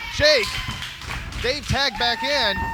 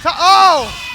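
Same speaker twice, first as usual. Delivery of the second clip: excited shout; 6 semitones higher, 10 dB louder than usual. Real, not acted. Live wrestling play-by-play reaction.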